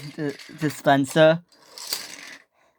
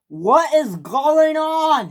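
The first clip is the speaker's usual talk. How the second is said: loud, high, very slow demand; shouted question; 10 semitones higher, 5 dB louder, much slower than usual. A narrator's reading. Engine repeating himself at the crash, drawn-out shout. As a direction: yell it out, dragging the words. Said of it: "he said it once again".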